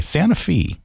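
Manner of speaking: ordinary talk, nothing marked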